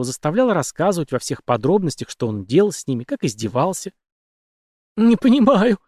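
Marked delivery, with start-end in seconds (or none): 5.0-5.8 high, shaky-edged frightened line; a trembling woman's protest. A narrator's reading. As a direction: say it with a tremble of fear. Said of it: "с дрожью в голосе произнесла Мэгги".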